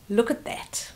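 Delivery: ordinary delivery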